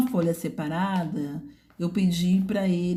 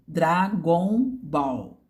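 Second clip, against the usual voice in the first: very slow, chopped into syllables like a beginner; syllable-by-syllable reading; much slower than usual. Shown as a demonstration. Teacher showing how a syllabic child reads "Dragon Ball" slowly. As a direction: drag out "Dragon Ball" one syllable at a time. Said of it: "ela vai ler".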